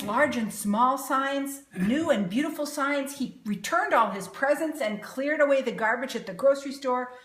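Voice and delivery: ordinary delivery, nothing marked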